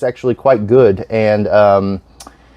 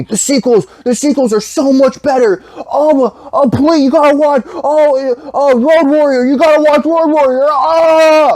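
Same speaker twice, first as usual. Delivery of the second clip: much higher, gushing mock fan voice; pushy fan. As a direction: squeal it like an overexcited fan begging you. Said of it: "everybody keeps telling me".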